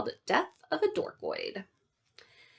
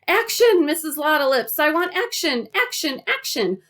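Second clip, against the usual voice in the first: high, excited director's call; megaphone shouting; 8 semitones higher than usual. A narrator's reading. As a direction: call for action as if over a film set. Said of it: "shouting through a megaphone".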